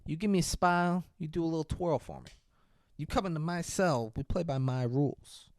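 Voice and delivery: lightly voiced creepy client, kept soft; softly wheedling mock seducer; creepy sweet-talk. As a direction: coax her quietly, imitating a controlling creep. Voicing creepy client sweet-talking lawyer.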